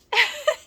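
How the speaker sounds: short, high burst of a laugh; high squeal of laughter. Real, not acted. Amused by the show's weird scene cuts, a high laugh. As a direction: let a squeaky giggle escape.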